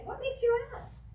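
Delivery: high, slow, curious turnaround question; a high, unhurried what makes you ask. Shown as a demonstration. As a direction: toss the question back with bright curiosity. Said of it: "and I say".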